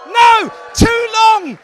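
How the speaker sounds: very high, very loud protest; outraged shout. Acted. Comedian scolding the crowd for hesitating over the nurse, outraged shout. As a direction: yell it in hammed-up indignation.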